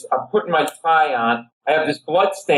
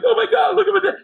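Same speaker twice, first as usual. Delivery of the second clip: very high-pitched imitated panic; frantic girlfriend; faster than usual. Girlfriend seeing her boyfriend bleeding, frantic. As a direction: shriek it like a horrified girlfriend.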